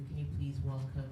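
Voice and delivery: ordinary delivery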